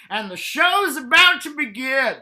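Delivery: high, loud, slow showman announcement; mock announcer. Labia as a stage curtain, hammed-up announcer.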